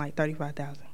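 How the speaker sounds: a secret aside, barely voiced; hushed confession of the salary; whispered aside. Real, not acted. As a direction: whisper the number. Aside about small salary.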